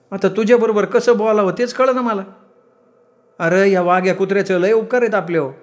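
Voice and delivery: high-pitched, reproachful mother's voice; aggrieved village mother. Acted. Mother reminding her son of the dog's favours, reproachful.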